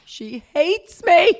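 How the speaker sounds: high, loud, drawn-out lament; hammed-up misery, stretched and high; mock distressed wail. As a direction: wail it out like it's a catastrophe. Acting out the miserable feeling.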